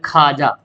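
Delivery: खाजा with no "w", "khaa-jaa"; as spoken. Shown as a demonstration. Teacher showing how it is read, खाजा without "w". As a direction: drop the "w", say खाजा. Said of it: "क्या बोलते हैं".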